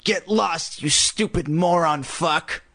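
slow, raspy dummy insult; harsh raspy snarl. A narrator's reading. Dummy insulting the sister, snarling. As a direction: draw out the insult in a rasp. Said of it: "snarled in a harsh, raspy voice".

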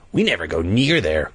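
plain reading voice

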